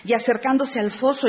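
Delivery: ordinary talk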